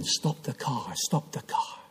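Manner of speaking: son's plea told in a hush; retold scream. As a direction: whisper the scream instead of yelling. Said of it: "He's screaming".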